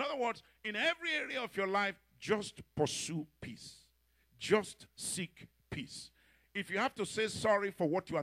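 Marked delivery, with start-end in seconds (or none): 2.2-6.1 drawn out, each word weighed; slow it right down to urge; slow exhortation. Acted. Preacher slows the exhortation.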